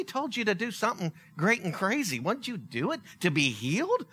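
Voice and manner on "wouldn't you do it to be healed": normal talking voice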